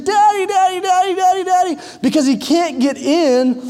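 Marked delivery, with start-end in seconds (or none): 0.0-1.8 hammed-up child screaming for daddy; retold little boy wailing at the door; mock toddler scream. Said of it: "letting out this blood-curdling scream".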